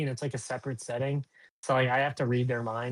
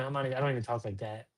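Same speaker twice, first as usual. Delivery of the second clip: usual speaking voice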